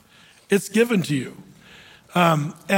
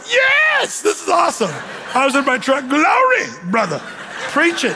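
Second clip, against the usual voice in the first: excited cheering; 6 semitones higher than usual. Retold or imitated. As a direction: cheer on the preacher from the truck. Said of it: "I was just like".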